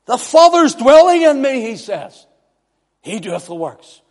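high, loud preaching building to a shout; preaching at full force. Real, not acted. Preacher quoting Jesus to the congregation, full-force preaching. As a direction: proclaim scripture with rising force, high and loud.

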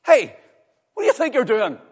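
sudden high, loud shout; outraged telling-off. Retold or imitated. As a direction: pull the child up sharply at full volume. Re-enacts scolding a swearing child.

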